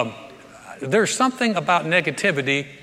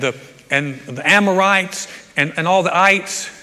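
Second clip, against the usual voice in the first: louder and louder mock panic; mock alarm building; 6 dB louder than usual. Mock panicked spy shouting the list of ites.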